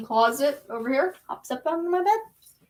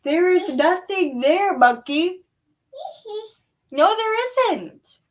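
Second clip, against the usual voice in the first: sing-song reassuring; 6 semitones higher, 3 dB louder, slower than usual. Real, not acted. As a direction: reassure the little one gently. Soothing baby again.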